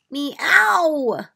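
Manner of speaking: loud high cat meow; a big cartoon cat meow. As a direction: yowl it like a cat. Punchline cat sound in joke.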